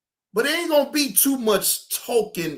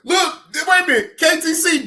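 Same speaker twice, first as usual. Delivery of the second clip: normal talking voice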